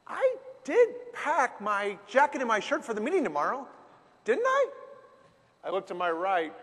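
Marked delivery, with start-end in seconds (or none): 0.1-4.7 rising into a panicked squeak on didn't I; dawning panic. Retold or imitated. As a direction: voice jumping much higher on the last words in dawning dread. Sudden worry while driving.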